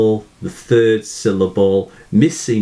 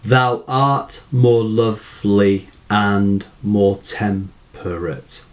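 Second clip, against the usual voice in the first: slow, evenly measured verse line; measured syllable-counting demo; slower than usual. Shown as a demonstration. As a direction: recite slowly so each syllable can be counted. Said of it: "these ten syllables".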